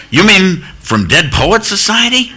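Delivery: high-pitched excited question; eager mistaken recognition. Retold or imitated. Guard mistaking the visitor's famous film, excited.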